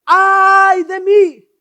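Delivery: the prophet crying out in dread; shouted cry of woe. Acted. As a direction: cry out in terror before God. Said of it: "gritó".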